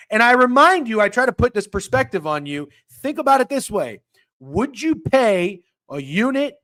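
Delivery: loud rant. Real, not acted. Heated rant to viewers.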